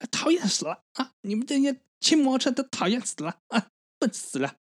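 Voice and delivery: put-on high shrill whiny old grandma; shrill palace servant. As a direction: squeal it like a fussy old eunuch. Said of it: "你为什么要学一个公公？".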